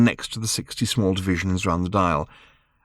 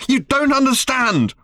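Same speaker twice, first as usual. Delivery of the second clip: put-on higher, slow frustrated girl yelling; shouting; 3 dB louder, slower than usual. A narrator's reading. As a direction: cry it out at your father.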